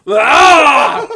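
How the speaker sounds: loud high mock scream. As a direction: yell back like a startled fan. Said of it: "I'll be like".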